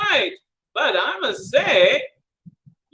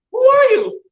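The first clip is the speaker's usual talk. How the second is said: high, loud teapot demand; 11 semitones higher, 10 dB louder than usual. Acted. Teapot demanding who the robber is, high and loud. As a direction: challenge him sharply.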